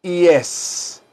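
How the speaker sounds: "yes." said as clean English yes; teacher's model word; correct pronunciation. Shown as a demonstration. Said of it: "se pronuncia".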